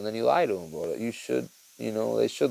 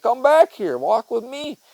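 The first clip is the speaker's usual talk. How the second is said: higher, louder parent call; calling kids back; 11 semitones higher, 6 dB louder, slower than usual. Retold or imitated. Dad calling his running kids back, raised and high. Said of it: "I'm like".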